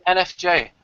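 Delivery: usual speaking voice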